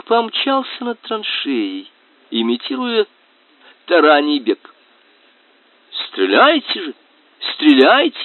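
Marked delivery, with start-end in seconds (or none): 6.1-8.2 high, loud urging; urgent shout. Acted. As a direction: yell at them to shoot. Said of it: "кричал наш ромбический приятель".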